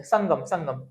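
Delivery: संगम said with the nasal cut short and abrupt; demonstrating a quick, jerked anusvar in संगम; clipped anusvar demo. Shown as a demonstration. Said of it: "बोलते समय हम लोग झटके से बोलते हैं".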